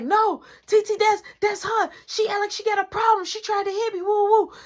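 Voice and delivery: rushing to tell her side; excited explaining. Retold or imitated. Cousin explaining the fight to the mom, high and eager. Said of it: "Anita was like".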